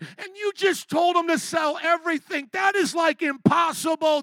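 high, loud panicked pleading; freaking out. Retold or imitated. Disciples protesting to Jesus about the rich man, loud and frantic. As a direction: disciples losing it, shouting at Jesus. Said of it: "They freak out".

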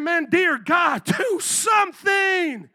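high, slow, loud frantic plea with a laugh; hammed-up terrified fisherman shouting for help; panicked cry.

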